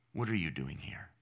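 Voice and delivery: sudden drop to a low, soft accusation; accusing inner voice. Acted. Preacher voicing the voice that turns believers back, hushed accusation. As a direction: land the question low and quiet. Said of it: "you will hear words that say something like this".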